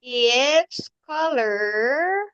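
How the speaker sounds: drawn-out, very slow model; very slow repeat. Shown as a demonstration. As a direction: stretch every word for the learner. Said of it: "teacher repeats model slower".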